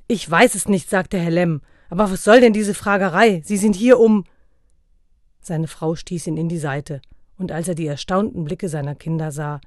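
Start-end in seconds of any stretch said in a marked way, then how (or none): 0.1-4.2 loud, indignant protest; father objecting to Santa, loud; irritated protest. A narrator's reading. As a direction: push back, offended.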